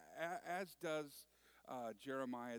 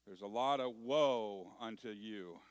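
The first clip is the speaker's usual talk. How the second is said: ordinary delivery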